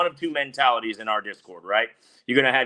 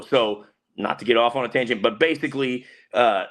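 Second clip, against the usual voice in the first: ordinary talk, nothing marked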